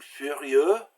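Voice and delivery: furieux said slowly, the final x silent; silent final consonant. Shown as a demonstration. Teacher showing silent endings, furieux with a mute x. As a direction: draw out furieux in French, no x sound. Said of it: "final consonants are usually silent".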